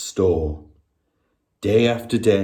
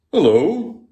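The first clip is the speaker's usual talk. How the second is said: watchman greeting; 7 semitones higher, 4 dB louder than usual. A narrator's reading. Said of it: "he said".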